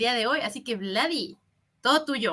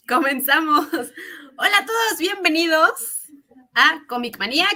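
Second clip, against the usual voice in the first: host intro; 5 semitones higher, 5 dB louder than usual. Acted. Show host opening.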